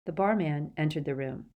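plain reading voice

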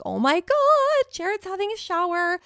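gleeful surprise. Retold or imitated. To herself, spotting her husband in the shower, thrilled. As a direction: squeal it like you just spotted the perfect target. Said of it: "And I was like".

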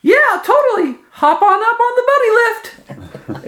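squeaky falsetto muscleman; very high, loud jock voice; high-pitched voice. Acted. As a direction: greet the crowd in a very high falsetto. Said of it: "says in a high-pitched voice".

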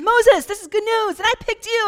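high, loud, bursting with enthusiasm; an overjoyed coach announcing the pick; excited God. Retold or imitated. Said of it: "And God's really excited".

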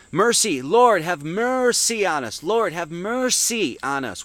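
"mercy" drawn out as "mer-sea"; mercy split up. Shown as a demonstration. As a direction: stretch both syllables of "mercy" slowly, mer... sea. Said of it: "What is mer?".

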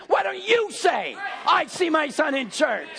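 fiery preacher urging the crowd to speak faith; preaching shout. Acted. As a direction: challenge the congregation hard. Preaching at full force.

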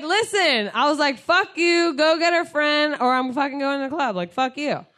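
high, loud mock tirade; retold angry outburst. Retold shouting match with bouncer.

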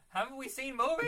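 loud, high, incredulous question; exasperated disbelief. Acted. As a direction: exclaim at your own stupidity. Mock exasperation at own past folly.

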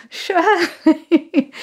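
high, laughing little phone voice; timid reply. Retold or imitated. Girl naming herself to crush's parent, mock timid. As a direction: give the name high, through a laugh.

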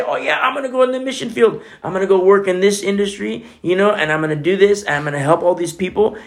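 eager naive convert. Retold or imitated. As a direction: rattle off the big plans naively. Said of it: "they say".

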